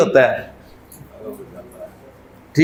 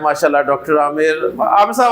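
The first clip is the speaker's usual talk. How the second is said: normal talking voice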